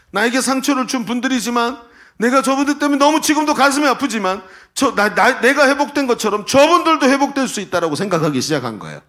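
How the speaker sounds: driving, emphatic sermon delivery; impassioned preaching. Acted. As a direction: preach it with rising force and urgency. Preaches at full force.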